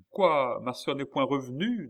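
high, loud first word, incredulous; dismayed question. A narrator's reading. Brother learning his sister has not returned, dismayed. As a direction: burst out in disbelief.

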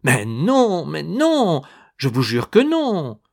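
plus aigu et plus fort; s'écrie. A narrator's reading. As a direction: protestez en vous écriant. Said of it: "Il s'écria". (cri de dénégation)

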